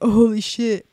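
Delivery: loud mock amazement; retold awe. Newcomer overwhelmed by the speaker's message, amazed. Retelling being overwhelmed at meeting.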